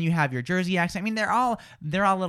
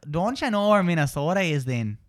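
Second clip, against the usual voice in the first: Minnesota accent with rounded O's; Upper Midwest accent. Shown as a demonstration. Host models the Upper Midwest accent for co-host. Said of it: "Upper Midwest".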